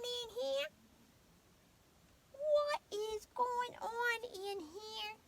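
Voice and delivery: high slow pet-talk voice. Real, not acted. Owner chatting with the dog in a high voice. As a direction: coo at the dog, teasing.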